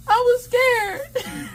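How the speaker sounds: very high squeal, breaking into laughter; hammed-up laughing whimper; tearful whine. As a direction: wail it like a mock-crying child. Whining defence while cracking up.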